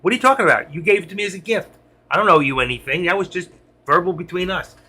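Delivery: hammed-up pretend denial of a debt; mock denial. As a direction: act out the cheat who denies the gift. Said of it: "I can say".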